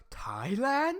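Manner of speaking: puzzled rising Thailand?; confused Thailand. Retold or imitated. Imitating Harry asking where he is, puzzled. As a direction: ask it as if clueless. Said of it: "He says it like that".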